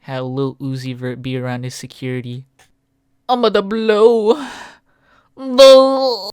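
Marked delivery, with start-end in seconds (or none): 3.3-6.3 cartoon voice. Meme voice build-up.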